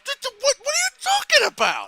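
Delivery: high, loud stammering exasperation; sputter, then burst out in a squeaky yell; frustrated Gavin. Retold or imitated. Friend imitates Gavin losing an argument, frustrated and shrill. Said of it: "Gavin gets frustrated".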